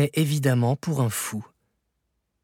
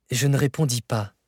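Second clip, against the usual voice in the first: usual speaking voice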